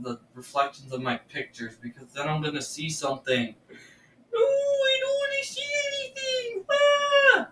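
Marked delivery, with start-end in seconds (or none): 4.3-6.6 high, crying plea in real fear; whimpering no in genuine fright; frightened cry. Real, not acted. Scared of seeing a ghost. 6.7-7.5 sudden high scared scream. Real, not acted. Scared of seeing a ghost.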